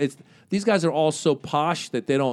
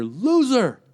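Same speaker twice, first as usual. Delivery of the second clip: mock heckler shouting from the back; higher, louder retold heckle; heckler yell; 8 semitones higher, 3 dB louder than usual. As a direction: call it out like a rude heckler.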